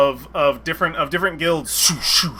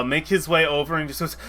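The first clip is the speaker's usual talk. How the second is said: ordinary talk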